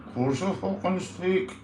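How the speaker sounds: a hint of a gobby wee puppet; sparring with its owner in a light puppet voice.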